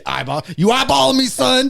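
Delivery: over-the-top indignant tough-guy challenge; mock confrontation. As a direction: pick a fight, hammed up. Prince challenging the bird, acted.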